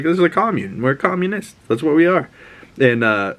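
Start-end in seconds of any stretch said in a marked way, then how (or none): 0.0-2.3 put-on higher, more insistent teen voice; repeated with more emphasis, higher; insistent Ellie. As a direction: push it up and dig in. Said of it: "she's like".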